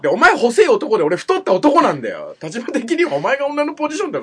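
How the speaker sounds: loud, high indignant arguing; heated, not calm. Real, not acted. Host argues the thin partner takes the woman's position, heated. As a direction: hammer home the ridiculous reasoning. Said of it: "一旦落ち着こう".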